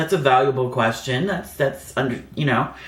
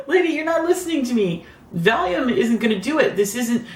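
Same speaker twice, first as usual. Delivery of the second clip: exasperated retort; 9 semitones higher than usual. Retold or imitated. Arguing with the nurse.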